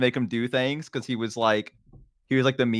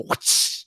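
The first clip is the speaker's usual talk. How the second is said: belt snap whoosh. Retold or imitated. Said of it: "snap it in the middle and go".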